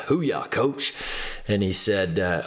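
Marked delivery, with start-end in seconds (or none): 0.1-0.9 higher-pitched retold rallying cry; team cheer. Trainees answering the coach's offer, retold team cheer. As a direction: lightly mimic an eager squad answering.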